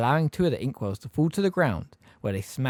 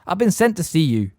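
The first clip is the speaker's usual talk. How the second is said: stiffly; 7 semitones higher, 6 dB louder than usual. A narrator's reading. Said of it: "said Harry stiffly".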